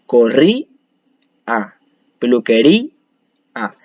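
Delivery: final "A" detached after a pause; stressed í lifted, then a separate "A"; split into syllables. Shown as a demonstration. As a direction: break "María", "corrí, A" and "peluquería, A" before the last vowel. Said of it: "así se separan en sílabas".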